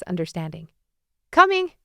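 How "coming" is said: calling back. A narrator's reading. Answering a call from afar.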